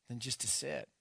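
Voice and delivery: usual speaking voice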